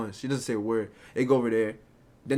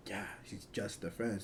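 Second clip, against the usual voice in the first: very soft, slow mimicked answer; quiet indifferent reply; 3 semitones lower, 14 dB quieter than usual. Girl brushing off his question about the other guy, quiet and slow. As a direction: answer slowly, barely bothering to voice it. Imitates her unemotional answer.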